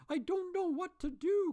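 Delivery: high, loud, giddy; half laugh half sob; laughing and crying. A narrator's reading. Scrooge waking redeemed, laughing and crying at once. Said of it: "laughing and crying in the same breath".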